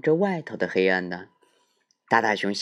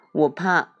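little bear voice; 7 semitones higher, 4 dB louder than usual. Acted.

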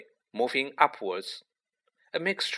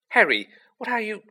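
surprised; 10 semitones higher, 4 dB louder, faster than usual. A narrator's reading. Ron alarmed at Harry.

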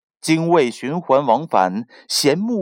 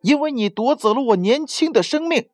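put-on high-pitched, indignant young bird's voice; angry little bird; 8 semitones higher than usual. A narrator's reading. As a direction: accuse the sea in a high, angry voice. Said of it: "精卫愤怒地说".

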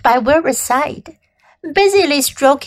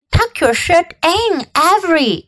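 higher, mildly scolding call; crying out; 5 semitones higher than usual. A narrator's reading. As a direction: call out to a boy, pitched up. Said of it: "cried Missus Zuckerman".

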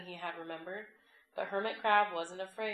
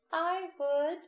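put-on high, creaking old coral voice; creaky voice; 10 semitones higher, 6 dB louder than usual. A narrator's reading. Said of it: "creaked a crusty coral".

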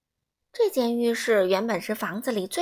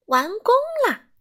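much higher and louder, delighted; triumphant; 12 semitones higher, 7 dB louder than usual. A narrator's reading. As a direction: crow it out. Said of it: "整洁先生说". (高声欢呼)

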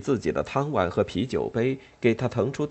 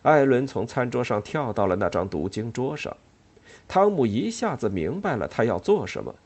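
plain reading voice

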